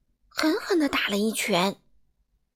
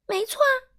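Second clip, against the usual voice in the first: much higher, unashamed "sure"; brazen admission; 14 semitones higher than usual. Acted. Troublemaker girl shamelessly admitting her mischief. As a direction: own it cheekily, no remorse. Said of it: "他理直气壮地说".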